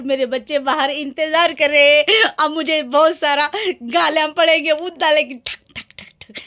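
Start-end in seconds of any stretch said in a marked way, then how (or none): 5.8-6.4 door knocking. Retold or imitated.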